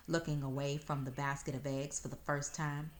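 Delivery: usual speaking voice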